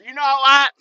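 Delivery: high, loud, gushing praise; gushing receiver. Retold or imitated. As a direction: rave at him like an impressed receiver. Receivers praising the old trucker.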